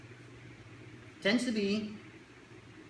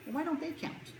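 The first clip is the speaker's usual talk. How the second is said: high, softer mock questioning; puzzled parent; 12 semitones higher, 5 dB quieter than usual. Parent to kid about friends, high puzzled question. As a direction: ask it pitched up, gently baffled.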